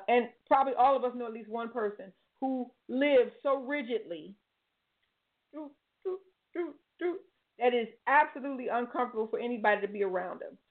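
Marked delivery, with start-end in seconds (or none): 5.5-7.2 soft, high little "doo" noises; quick separate doos, like a stiff robot; imitated rigid beeping. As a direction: mimic rigidity in small high toots. Mimes a rigid person's manner.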